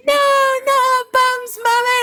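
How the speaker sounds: very high, slow, loud squeal; squealing kids. Retold or imitated. A mum imitating her children fleeing the bite, very high squeal. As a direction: shriek like little ones being chased. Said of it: "They're like".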